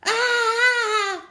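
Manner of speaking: loud high shriek of fright; terrified scream. Acted. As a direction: scream in sudden terror. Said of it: "screamed Steve".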